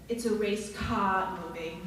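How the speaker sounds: slow, lightly Australian reading; a hint of Nicole's Australian vowels; Australian accent. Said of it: "in her Australian accent".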